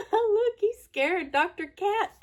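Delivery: ordinary delivery, nothing marked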